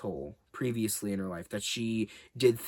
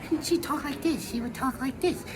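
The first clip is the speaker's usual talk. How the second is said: much higher girlish imitation; little girl voice; 13 semitones higher than usual. Vlogger imitating Pamela Anderson's voice, high little-girl voice. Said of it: "high-pitched, little girl voice".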